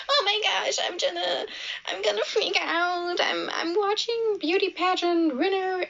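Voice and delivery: high, hammed-up nervous gushing; mocking Gracie. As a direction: imitate her fake freak-out, high and silly.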